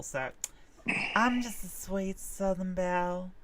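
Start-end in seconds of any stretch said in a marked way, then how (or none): 1.2-3.3 soft, slow Southern Belle drawl; sweet Southern Belle, quiet and unhurried; Southern Belle accent. Shown as a demonstration. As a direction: play the demure Southern lady. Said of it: "I do a Southern Belle".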